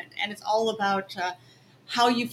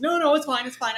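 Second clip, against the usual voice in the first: imitated brush-off, higher and louder; dismissive protest; 4 semitones higher, 4 dB louder than usual. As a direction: mimic someone waving off all the fuss. Acts out his dismissive protest.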